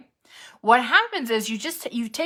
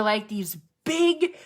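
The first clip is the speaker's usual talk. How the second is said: normal talking voice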